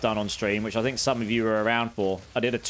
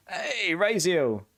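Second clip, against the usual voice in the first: slow, higher hello; drawn-out greeting; 7 semitones higher, much slower than usual. Real, not acted. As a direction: stretch the greeting out warmly. Greeting a chatter.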